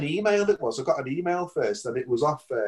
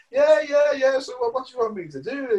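eager mock excitement; 11 semitones higher than usual. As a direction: gush along like it's no big deal. Pretending to play along.

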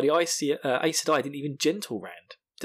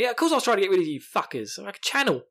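higher, quick ranting imitation; mock exasperated retort; 7 semitones higher, 3 dB louder, faster than usual. Host as Rand snapping at his friends, exasperated. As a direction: burst out in hammed-up exasperation.

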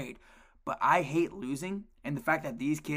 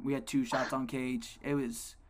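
normal talking voice

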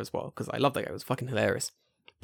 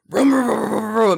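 loud, raised imitated revving; vocal impression of a roaring motorcycle; motorbike engine noise; 6 semitones higher, 6 dB louder than usual. Hearing teacher's Harley on the walk home, mimicked engine. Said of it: "and I hear this".

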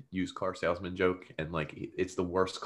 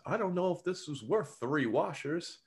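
mock Luigi; 7 semitones higher than usual. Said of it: "Luigi says".

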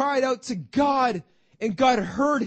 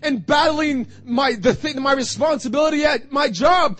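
loud, rising sermon build; impassioned preaching; 4 semitones higher, 4 dB louder than usual. Acted. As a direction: preach it with mounting fervour.

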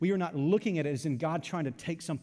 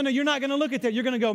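normal talking voice

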